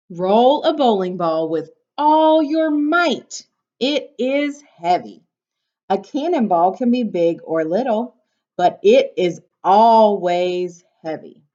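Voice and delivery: bright, lively storybook read; reading to children. Acted. Teacher reading a picture book to her class, bright and lively.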